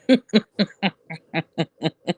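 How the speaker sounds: long rolling cackle; drawn-out helpless laughter; real laughing fit. Real, not acted. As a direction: crack up at your own blunt joke. Laughs at own blunt remark.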